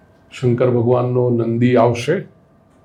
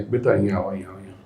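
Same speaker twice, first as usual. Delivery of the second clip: tender grandmother; 4 semitones lower, 7 dB quieter, much faster than usual. Retold or imitated. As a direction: lower and softer coaxing. Grandmother gently calls child. (દાદીમાનો પ્રેમાળ ધીમો અવાજ)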